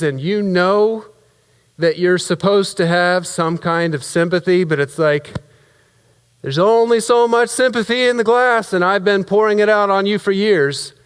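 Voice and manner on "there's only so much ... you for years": exasperated. Acted. Venting at brother, sympathy used up.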